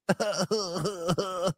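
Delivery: soft, stuttering imitation chuckle; put-on run of low chuckles; mock Pat laugh. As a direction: mimic a silly droning laugh. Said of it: "[name] laughs like it's Pat from S N L".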